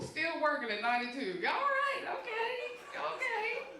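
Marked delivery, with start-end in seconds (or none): 1.5-3.7 mock delighted squeal. Mock delight at rich attorney.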